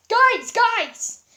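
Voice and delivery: high, loud excited call; excited shouting. A narrator's reading. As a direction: bounce with joy as you call. Sans jumping up and down.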